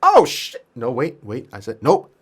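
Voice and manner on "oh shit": sudden high, loud outburst; startled realisation. Real, not acted. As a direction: blurt it out in surprise. Realises own mistake.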